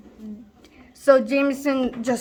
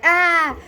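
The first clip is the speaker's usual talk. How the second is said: higher, louder "ah" of a reckless charge; short mock battle cry; charging yell; 5 semitones higher, 4 dB louder than usual. Friend running headlong into a wall, yelling.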